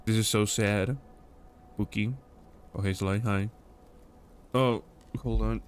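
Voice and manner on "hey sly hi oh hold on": usual speaking voice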